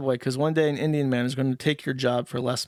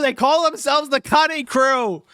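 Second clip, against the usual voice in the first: hysterical squeal; 9 semitones higher, 6 dB louder than usual. Acted. Hysterical repeated rant.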